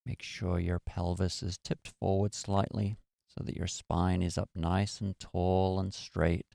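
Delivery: guided meditation. Acted. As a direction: ease them into stillness. Leads a meditation.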